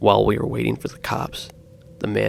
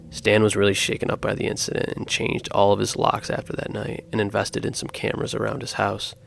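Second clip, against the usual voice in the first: usual speaking voice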